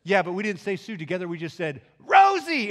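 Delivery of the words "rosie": very high, loud cry of the name; shouted punchline. Retold or imitated. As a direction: belt out the name together. Both shouting the punchline together.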